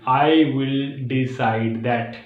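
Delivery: very slow, decide split into parts; broken up, slow. Shown as a demonstration. Teacher showing the broken-word method slowly. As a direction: stretch it out and break decide. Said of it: "तो ऐसे आप easily बोल सकते हो".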